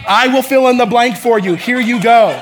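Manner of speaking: loud, high, emphatic announcement; God. Retold or imitated. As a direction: boom it out as a divine offer. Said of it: "God literally is like".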